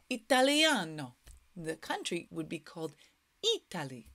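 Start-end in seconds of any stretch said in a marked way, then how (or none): 3.6-4.1 "Italy" in an Italian accent, low. Shown as a demonstration. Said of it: "It's a slightly different accent".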